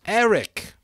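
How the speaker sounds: high, loud, sharp call of the name; sharp shocked cry. A narrator's reading. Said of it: "cried Ralph in a sharp, shocked voice".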